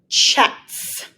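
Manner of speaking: "Chet's" whispered with a hard ch; teacher whispering "Chet's"; whispered sound. Shown as a demonstration. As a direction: breathe out "Chet's" to show the sound. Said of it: "Say the word Chet's".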